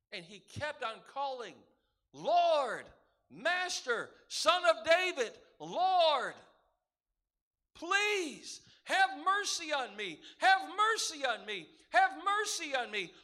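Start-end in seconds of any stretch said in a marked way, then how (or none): 2.1-6.3 loud, carrying cry of a blind beggar; calling out. Acted. Blind beggar calling to Jesus over the crowd, loud and pleading. 7.7-13.1 loud, higher, pleading cry; desperate pleading. Acted. Blind man crying to Jesus for mercy, loud and desperate.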